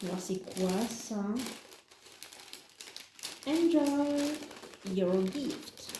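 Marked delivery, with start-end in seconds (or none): 3.5-5.9 playful fake English accent, reading the card; mock English accent. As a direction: put on a jokey English accent. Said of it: "Avec mon super accent anglais".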